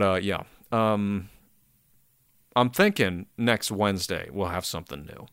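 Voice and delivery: ordinary talk, nothing marked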